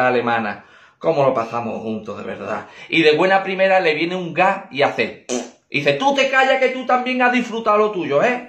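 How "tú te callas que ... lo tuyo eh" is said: penis talking back. Acted. Penis answers the old man.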